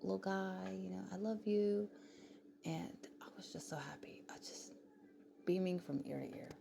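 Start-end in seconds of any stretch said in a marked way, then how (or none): 0.0-1.9 retold yell. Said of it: "And then I yell out".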